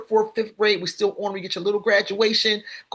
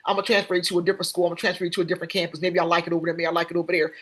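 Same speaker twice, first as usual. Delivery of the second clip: breezy quick brush-off; much faster than usual. Retold or imitated. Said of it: "We just say".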